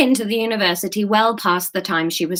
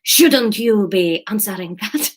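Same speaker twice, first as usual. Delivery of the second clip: put-on slow, sultry Spanish accent; sexy Spanish accent; 3 semitones higher, slower than usual. New character asks about a phone, sexy Spanish accent. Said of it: "he sounds like he's very sexy".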